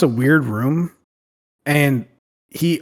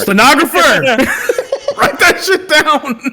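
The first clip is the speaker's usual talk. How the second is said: high, laughing, bossy order; laughing mock command; 11 semitones higher, 4 dB louder than usual. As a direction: bark it out while cracking up.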